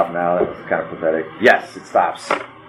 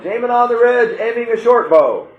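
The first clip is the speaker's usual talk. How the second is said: high-pitched warning cry, words clear; yelling; 13 semitones higher than usual. Acted. Game master relays the warning players heard, clear yelling. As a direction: call the warning out clearly over distance. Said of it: "Brunson yelling".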